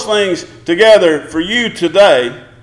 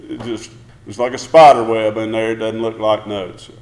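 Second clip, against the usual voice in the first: mock grumble; 7 semitones lower than usual. Mocking a bored churchgoer.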